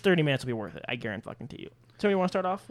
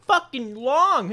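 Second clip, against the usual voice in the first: drawn out; 8 semitones higher, 6 dB louder, much slower than usual. Real, not acted. Bragging about the trout.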